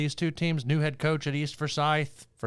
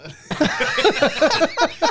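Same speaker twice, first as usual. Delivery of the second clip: high cackling laugh; 4 dB louder than usual. Real, not acted. Big laugh after the chant.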